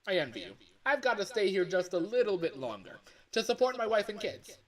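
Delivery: loud, high, chirpy phone guy greeting; hammed-up cheery night-shift boss on the line; phone guy character. Game dev voicing the phone guy for his fan game, loud and high. Second take of phone call.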